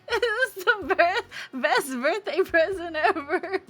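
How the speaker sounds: sobbing through the words; crying with joy. Real, not acted. Birthday girl thanking her friends, crying happy tears. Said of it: "I'm crying again".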